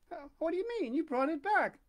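high, bewildered protest; baffled friend; confused lady. Acted. Forestier voiced, confused.

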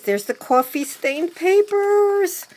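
high, loud excited exclamation; rising into a loud, high squeal; excited delight. Real, not acted. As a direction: sing out the discovery, thrilled. Delighted discovery in the gift.

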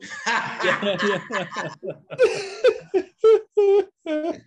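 loud high helpless laughter; big laugh. Real, not acted. Storyteller laughing hard at his own punchline. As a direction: laugh till it cracks.

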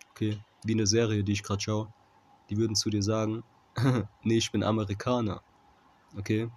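ordinary talk, nothing marked

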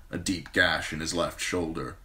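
ordinary delivery, nothing marked

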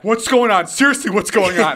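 high, loud, insistent questioning; urgent mock panic. Tripping friend pressing driver for answers, urgent mock panic. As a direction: imitate a friend demanding answers in alarm.

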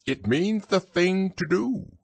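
high-pitched owl voice; high storybook owl; explaining owl. A narrator's reading.

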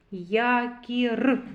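Якір slowly, the closing Р sounded out; stress on final Р. Shown as a demonstration. Teacher isolates the last sound, Р emphasized. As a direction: linger on the Р ending Якір. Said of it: "р, твердий приголосний".

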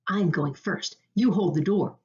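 ordinary delivery, nothing marked

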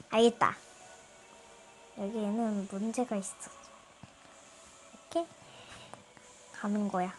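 ordinary talk

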